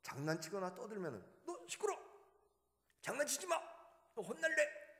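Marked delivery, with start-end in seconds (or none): 1.5-5.0 high, loud shouting at noisy pupils; scolding teacher yell. Retold or imitated. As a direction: bark it like an angry teacher. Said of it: "한 분은 들어와서".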